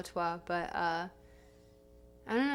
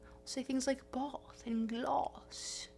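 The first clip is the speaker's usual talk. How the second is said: British reading voice; 3 semitones higher, 5 dB quieter, slower than usual. Shown as a demonstration. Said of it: "When I'm reading".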